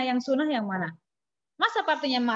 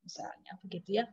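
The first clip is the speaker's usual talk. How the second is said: whispered aside; 6 semitones lower, 11 dB quieter, faster than usual. Real, not acted. Teacher's aside during lesson.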